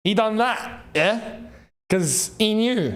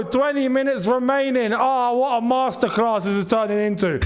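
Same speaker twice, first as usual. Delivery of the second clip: ordinary delivery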